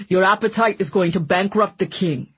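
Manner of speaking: grumbling northern English butler; northern accent. A narrator's reading. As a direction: complain darkly in a northern accent. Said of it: "in his northern accent".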